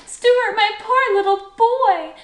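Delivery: very high, loud motherly wail of concern; dismayed cry. A narrator's reading. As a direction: cry out in pity for the child. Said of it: "she cried".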